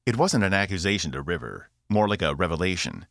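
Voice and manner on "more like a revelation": usual speaking voice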